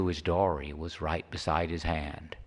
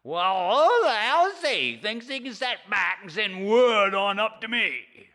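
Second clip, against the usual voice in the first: put-on much higher, loud, scoffing old man; high cranky fisherman scoffing; sarcastic Charlie; 12 semitones higher, 6 dB louder than usual. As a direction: sneer back mockingly.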